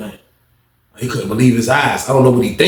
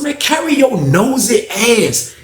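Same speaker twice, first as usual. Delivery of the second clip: high, slow put-on voice brushing him off; mock high dismissive voice; 12 semitones higher, much slower than usual. Inmate brushing off a nosy laundry worker, high mocking voice.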